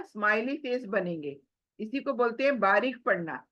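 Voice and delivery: ordinary delivery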